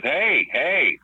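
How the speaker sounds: high-pitched call to break it up; mildly acted confrontational call; retold challenge. Wrestler stepping in to defend the storyteller, challenging.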